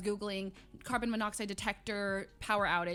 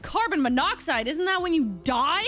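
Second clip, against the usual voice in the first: loud, higher panicked question; alarmed disbelief; 6 semitones higher, 8 dB louder than usual. Retold or imitated. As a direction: blurt it out in hammed-up alarm.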